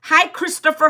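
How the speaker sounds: ordinary talk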